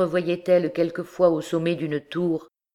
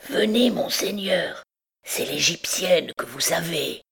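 sepulchral messenger; 3 semitones higher than usual. A narrator's reading. Hunchback reveals who is waiting, sepulchral pleading. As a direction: coax him with an eerie half-whisper. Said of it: "à la voix sépulcrale de l'étrange messager".

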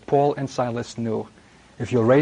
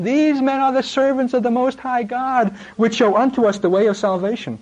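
put-on much higher, shrieking demon-girl cry; shrill possessed girl; 9 semitones higher than usual. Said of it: "freaky, shriekish, shrill intonation".

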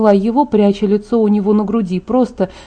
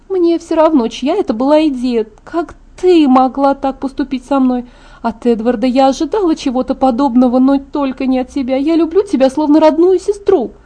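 put-on higher-pitched young woman's character voice; hurt Alice, reproachful; 4 semitones higher than usual. Alice reproaches her sister-like friend, hurt, high-pitched.

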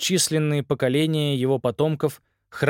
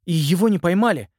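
determined; 8 semitones higher, 4 dB louder than usual. Acted. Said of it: "решительно добавил Саймон".

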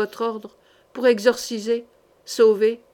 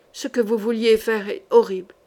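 ordinary delivery, nothing marked

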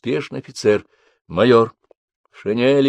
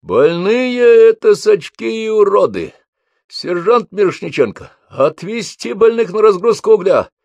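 shouting drill sergeant; 11 semitones higher, 7 dB louder than usual. A narrator's reading. Sergeant barks orders.

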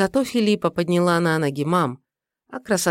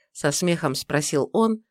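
ordinary delivery

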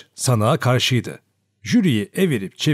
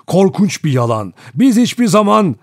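outraged protest; 8 semitones higher, 6 dB louder than usual. A narrator's reading. Rogers denying the accusation, protest rising to a cry. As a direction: protest building louder and higher.

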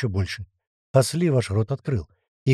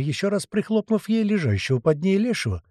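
plain reading voice

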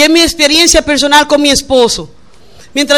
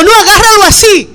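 higher, louder bossy correction; mock yelling; 6 semitones higher, 4 dB louder than usual. Girlfriend bossily correcting his grip, mock yell. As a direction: snap the instruction, half-joking. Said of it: "ella no le gritó".

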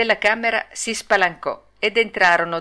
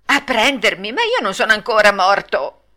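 mild acted alarm; 3 semitones higher, 5 dB louder than usual. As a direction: protest with a touch of panic. Pinocchio protests to undertaker rabbits.

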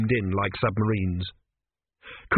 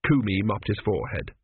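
usual speaking voice

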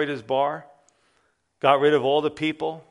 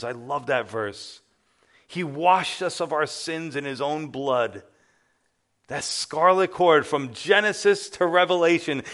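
normal talking voice

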